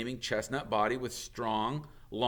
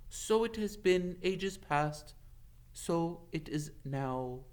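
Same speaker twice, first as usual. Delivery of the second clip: a hint of a grave old centaur; 8 semitones higher, slower than usual. Ronan greets Hagrid, slow and solemn.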